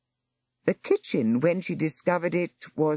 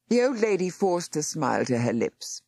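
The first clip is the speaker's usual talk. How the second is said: ordinary delivery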